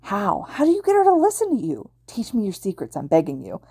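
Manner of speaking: high-pitched, incredulous plea; awed begging. A narrator's reading. Power Loader begs Izuku for his secret, awed. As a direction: squeak in disbelief, then drop to begging. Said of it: "an unrestrained look of awe".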